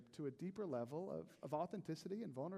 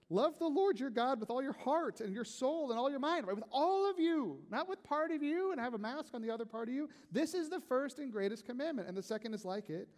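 higher and louder scripture proclamation; full-force preaching; 9 semitones higher, 7 dB louder than usual. Acted. Pastor quoting Jesus to his church, full force. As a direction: proclaim the commandment to the room.

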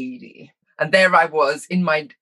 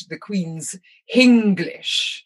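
very slow, affected Caribbean take on British; mock-British Caribbean accent; 3 semitones higher, much slower than usual. Mimicking Caribbean speakers attempting Queen's English, affected accent. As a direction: draw out Hinglish with an added H, in mock-posh Caribbean accent. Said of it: "see as a very British".

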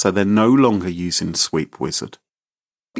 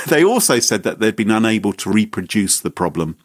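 usual speaking voice

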